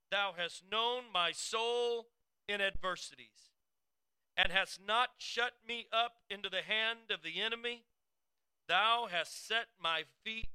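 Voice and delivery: high, loud, slow preaching read; scripture reading. Acted. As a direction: proclaim each verse like a sermon from the pulpit. Preacher reads Psalm 31 aloud.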